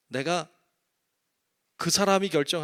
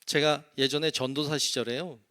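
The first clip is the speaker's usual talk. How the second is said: ordinary delivery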